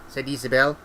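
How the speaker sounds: ordinary delivery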